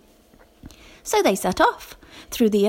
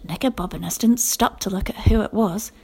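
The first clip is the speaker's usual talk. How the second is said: ordinary delivery, nothing marked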